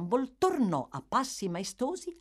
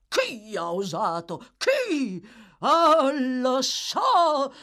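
loud, indignant outburst, building higher; outraged; 8 semitones higher, 7 dB louder, much slower than usual. A narrator's reading. Bumble on behalf of the offended lady, outraged. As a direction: demand the culprit, then burst out knowingly. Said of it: "dominandosi con una innata maestosità".

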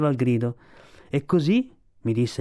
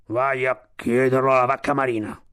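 gruff walrus reply, dragged out; grudging Sivic. A narrator's reading. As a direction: answer as a grumbling old walrus. Old walrus grudgingly answers.

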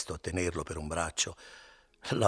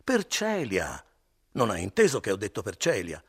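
shouted in his face; 3 semitones higher, 7 dB louder than usual. Acted. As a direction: shout him down, up close. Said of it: "gridò questo sul viso di Renzo".

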